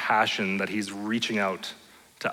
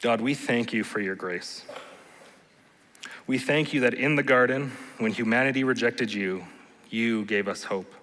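closing prayer. Acted. Pastor leads closing prayer.

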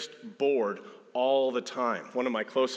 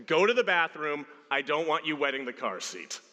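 raised, insistent dad voice; stern parent order; 5 semitones higher than usual. Acted. Dad to two little boys before a car ride, stern order. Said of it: "To my two little boys".

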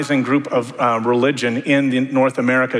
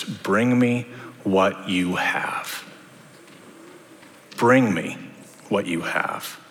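gentle Jesus; 4 semitones lower, 5 dB quieter, much slower than usual. Acted. Jesus voiced slowly to disciples.

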